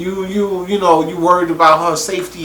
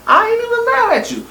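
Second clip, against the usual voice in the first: squealing high, egging them on; high-pitched cheering. Retold or imitated. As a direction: shriek like a cheering fan. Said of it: "I'm like".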